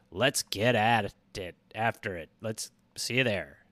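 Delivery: low, rough rally cry to the fans; low, gruff sign-off; gruff rally call. Acted. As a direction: growl it out to the Garmy.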